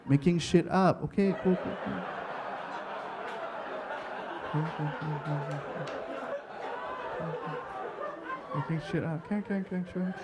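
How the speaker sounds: soft, grudging mumble; muttered aside. Retold or imitated. Husband doubting wife's story, quiet grudging agreement. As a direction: mutter it under your breath, placating.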